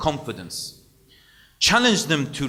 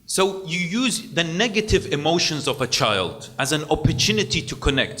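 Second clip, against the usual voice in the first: ordinary talk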